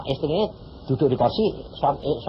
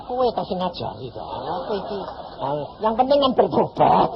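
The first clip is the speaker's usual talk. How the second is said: ordinary talk, nothing marked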